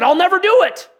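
high, loud, cocky promise; boastful vow. Retold or imitated. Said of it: "the one guy who said".

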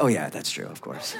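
low, very soft, matter-of-fact acceptance; mock ready belief. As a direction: murmur agreement as the unsurprised mom. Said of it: "And she's like".

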